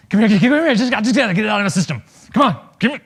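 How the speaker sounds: loud, high, fast eager dog voice; hammed-up dog itching to bite; eager biting dog. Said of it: "They don't go around going".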